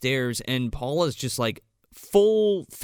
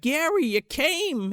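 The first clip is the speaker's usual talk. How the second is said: high, slow gushing welcome; lightly voiced thrilled hostess; hammed-up joy at Gary arriving; mock delighted greeting; 12 semitones higher, slower than usual. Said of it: "she's like".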